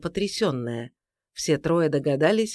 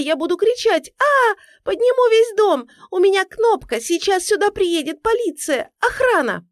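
high, loud panicked screaming; frightened woman; 6 dB louder than usual. A narrator's reading. Woman threatening an intruder with police, panicked shrieks. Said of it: "потом испуганный женский голос".

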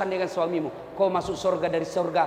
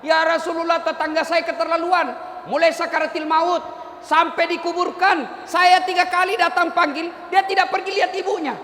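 raised, higher voice reporting the neighbour to the Prophet; angry complaint; 6 semitones higher than usual. Retold or imitated. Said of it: "marah dia pergi lapor sama Nabi". (tetangga mengadu kepada Nabi dengan marah)

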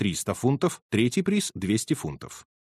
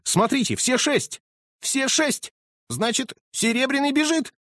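high, excited exclamation; great excitement; 13 semitones higher than usual. Acted. Colonel sees the horses lining up, high and thrilled. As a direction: burst out thrilled. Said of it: "воскликнул полковник в сильнейшем волнении".

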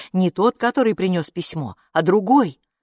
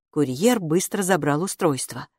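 plain reading voice

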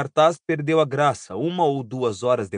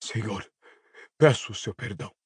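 low, hoarse begging servant; husky, submissive apology; hoarse plea; 4 semitones lower, 3 dB quieter than usual. A narrator's reading. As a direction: beg pardon hoarsely, pitched down. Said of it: "disse rouco o homem ajoelhado no chão".

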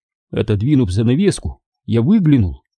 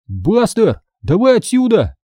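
high-pitched pleading call; near begging; 10 semitones higher than usual. Acted. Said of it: "чуть ли не умоляю я". (почти умоляюще)

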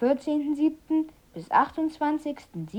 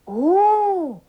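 begeistert; 6 semitones higher, 5 dB louder than usual. Acted. Kalle sieht das Geschenk.

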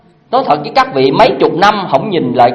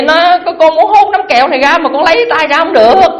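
put-on a higher, whiny little child; child's voice; 8 semitones higher than usual. The child answers his mother about the jar, whiny. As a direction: plead like a stuck little kid.

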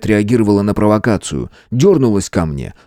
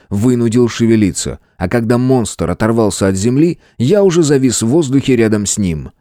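plain reading voice

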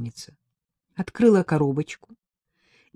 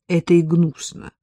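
ordinary delivery, nothing marked

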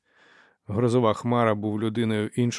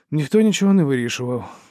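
loud, higher-pitched protest; butting in; 5 semitones higher, 7 dB louder than usual. A narrator's reading. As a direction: jump in loudly to object. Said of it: "встряв Шукач". (гучне заперечення, встряючи в розмову)

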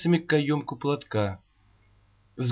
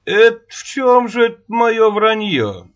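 dignified; 7 semitones higher, 5 dB louder than usual. Acted. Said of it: "С достоинством взглянул на неё Рогачёв".

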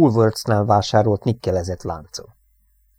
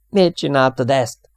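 loud, slow, raised demand; accusing yell; 5 semitones higher, 5 dB louder than usual. A narrator's reading. Airman confronts the crewmate who threw his medal, yelling. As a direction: shout it as a stricken accusation. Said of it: "ordított Jackre".

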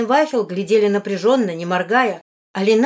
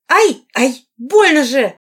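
high, loud yelps of pain; moaning in pain; 6 semitones higher, 9 dB louder, slower than usual.

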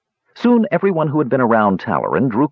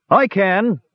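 high, lightly raised call; shout across the room; 11 semitones higher than usual. A narrator's reading. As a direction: call out from the far side. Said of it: "someone shouted".

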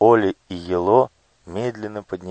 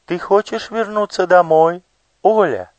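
much higher, ringing magic mirror voice; ringing crystal chime voice; 12 semitones higher, 5 dB louder than usual. Acted. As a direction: ring out like crystal glass. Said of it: "красивый звенящий голос, будто ударились друг о дружку хрустальные стёклышки".

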